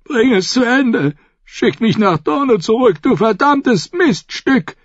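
high, strained begging turning to a curse; dying plea. A narrator's reading. The maimed Dornishman begs Brienne to finish him, desperate. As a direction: beg and curse with your last strength.

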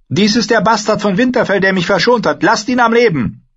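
high-pitched wildling woman, pleading forcefully; urgent wildling woman. Acted. Wildling woman vouches for the bastard who spared her, urgently. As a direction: plead for his life in a high voice.

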